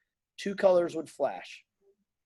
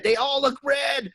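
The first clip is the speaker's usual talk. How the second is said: much higher and slower, desperate repeat; rising mock panic; 10 semitones higher, 4 dB louder, slower than usual. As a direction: stretch it out, pleading in mock despair.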